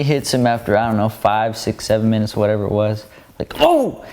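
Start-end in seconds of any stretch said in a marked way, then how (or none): 3.6-4.0 much higher, sudden startled yelp; sudden realization; surprised. Retold or imitated. Driver realizes he's on shoulder.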